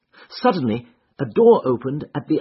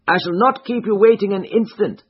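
lightly voiced colonel, pitched higher; a higher-pitched character voice for a polite host's promise; Colonel Stark; 7 semitones higher, 4 dB louder than usual. Colonel's quoted line in narration.

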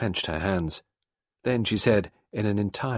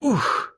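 high puff of exertion; winded exclamation; loud oof; 4 dB louder than usual. A narrator's reading. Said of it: "uttered a loud".

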